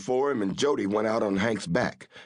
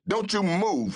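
shouted; 11 semitones higher than usual. A narrator's reading. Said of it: "he shouted at Jackson".

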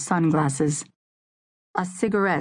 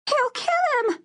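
very high panicked shriek. A narrator's reading. A bystander sees the gun and shrieks in panic. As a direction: scream it in sudden terror. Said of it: "someone shrieked".

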